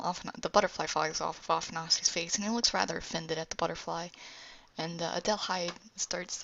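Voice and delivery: usual speaking voice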